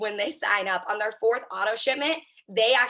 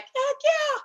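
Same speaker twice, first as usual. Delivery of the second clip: a squeaky, much higher mock-excited "heck yeah"; eager yes. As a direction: imitate a thrilled customer, pitched way up. Customers eagerly agreeing, acted quote.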